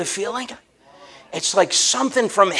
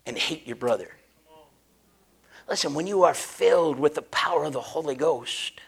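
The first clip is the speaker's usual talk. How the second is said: soft, low, intimate sermon voice with whispers; hushed preaching; 3 semitones lower, 5 dB quieter than usual. Acted. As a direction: draw the congregation in quietly.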